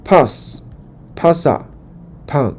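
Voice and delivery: very slow, drawn-out recitation; slow model reading. Shown as a demonstration. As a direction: stretch each form for students to repeat. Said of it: "我们就一起来读一读哈". (老师放慢示范朗读)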